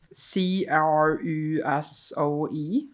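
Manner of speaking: slow, letter by letter; spelling out. Shown as a demonstration. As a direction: spell it one letter at a time. Spelling out the name.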